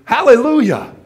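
loud, high cry of Hallelujah; joyful church shout. Retold or imitated. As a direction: shout it out to get the donkey going. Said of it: "shout loudly".

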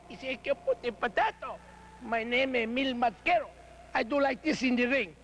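lightly put-on broken English with a Spanish accent; mock Mil Mascaras. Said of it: "half-wits that speak broken English".

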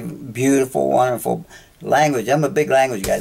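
ordinary delivery

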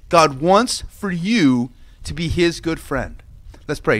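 ordinary delivery

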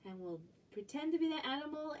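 ordinary talk

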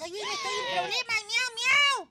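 very high, wailing cry for help; panicked plea. Acted. Cat in trouble over a broken window, panicked wailing. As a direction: wail it high, ending in loud meows.

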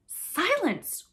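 loud, high cry for silence; sharp cry. A narrator's reading. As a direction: call the noisy children to order. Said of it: "cried Wendy".

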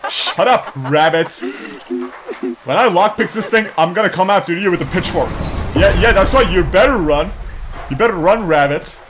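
loud, high-pitched tirade; mock-angry threat. Player threatening the rabbit with a pitchfork, mock angry. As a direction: threaten the rabbit with hammed-up outrage.

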